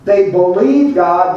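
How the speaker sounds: ordinary talk, nothing marked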